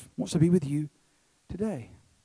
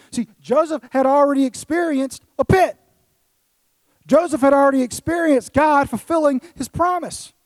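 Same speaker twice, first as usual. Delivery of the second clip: raised, loud sermon delivery, climbing higher; forceful preaching; 8 semitones higher, 7 dB louder than usual. Real, not acted. Preacher telling the congregation of Joseph's faith, forceful preaching. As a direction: preach it with mounting conviction, punching key words.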